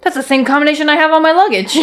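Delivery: high, fast, proud blurt; dim-witted king voice. Retold or imitated. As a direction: blurt it out, pleased with himself. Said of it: "He goes".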